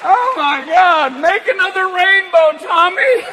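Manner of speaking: stoned firefighter. Retold or imitated. Firefighter calling to Tommy in stoned delight. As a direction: marvel at the smoke like a happy stoner. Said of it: "the guys fighting the fires are like".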